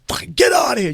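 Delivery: high, loud dismissive shout; cop shouting. Retold or imitated. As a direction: chase him off angrily. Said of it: "he goes".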